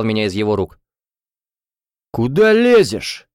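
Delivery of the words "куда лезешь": high, loud, drawn-out challenge; angry retort. A narrator's reading. Drunk student to her defender, snappish. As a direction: snap back at someone butting in. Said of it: "огрызнулся тот".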